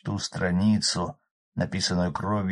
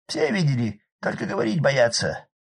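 high loud rough village man; hoarse peasant shout; 5 semitones higher, 4 dB louder than usual. Acted. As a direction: bellow over the roaring fire. Arkady voiced.

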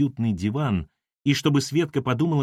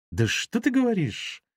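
drawled mockingly; 11 semitones higher than usual. A narrator's reading. Ira mocking Dima's denial, drawling. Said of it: "протянула она и усмехнулась".

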